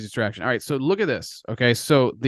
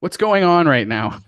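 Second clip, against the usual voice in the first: surprised; 6 semitones higher, 5 dB louder than usual. Real, not acted. Chart suddenly drops.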